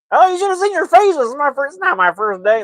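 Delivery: very high, loud, teasing shriek; hammed-up gotcha. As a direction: crow over the prank at the top of your voice. Instructor's hammed-up just-kidding outburst.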